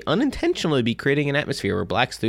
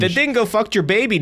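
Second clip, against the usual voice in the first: put-on layered Irish accent; 8 semitones higher than usual. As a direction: pretend badly to be Irish, hammed up.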